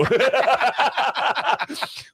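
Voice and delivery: very high squealing laugh. Real, not acted. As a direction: let out a high cackle. Laughing at the memory.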